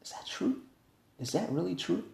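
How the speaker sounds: soft whisper, asking in disbelief; whisper to parents. Retold or imitated. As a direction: lean over and whisper, stunned. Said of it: "I looked at my parents".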